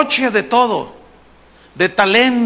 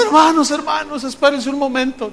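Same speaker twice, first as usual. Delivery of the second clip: higher-pitched mock weepy address; acted tearful appeal, lightly done; tearful organizer; 6 semitones higher than usual. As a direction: imitate a man choked up before a crowd. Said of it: "¿Por qué está llorando?".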